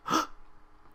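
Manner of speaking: breathy surprised gasp; sharp gasp. Acted. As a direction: gasp in sudden realization. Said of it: "Pinkie gasped".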